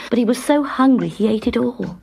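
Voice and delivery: usual speaking voice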